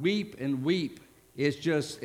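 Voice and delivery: ordinary talk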